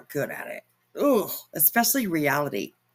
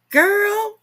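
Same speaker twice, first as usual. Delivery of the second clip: shocked gasp; 7 dB louder than usual. Real, not acted. Gasped reaction to a claim.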